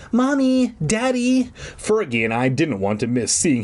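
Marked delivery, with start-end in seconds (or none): 0.1-1.5 high-pitched panicked calling; mock scream. A narrator's reading. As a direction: wail for the parents in a high voice. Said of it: "I heard him scream".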